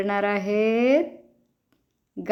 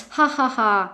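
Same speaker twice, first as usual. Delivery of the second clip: laughter yoga laugh; 2 semitones higher than usual. Pretend.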